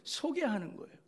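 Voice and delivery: "소개하는" pitched high and pressed. Shown as a demonstration. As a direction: lift "소개하는 거예요" high with conviction.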